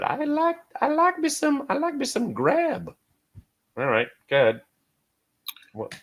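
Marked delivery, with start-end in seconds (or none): 0.0-2.9 very high singsong squeak; goofy high-pitched enthusiasm; playful falsetto. Real, not acted. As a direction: croon your liking in a silly high voice.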